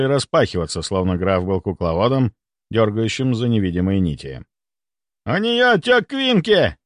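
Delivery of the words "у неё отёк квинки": high, slow, drawn-out yell; frantic yelling. A narrator's reading. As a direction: keep yelling the emergency out. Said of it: "продолжал орать Бестужев".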